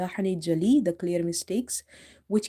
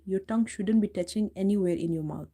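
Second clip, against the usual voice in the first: ordinary delivery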